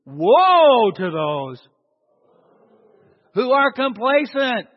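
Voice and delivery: "Woe" long, loud and drawn out; loud, emphatic proclamation of "Woe"; with emphasis. Shown as a demonstration. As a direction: hit "Woe" hard, then drive the warning home. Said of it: "wait, with some emphasis".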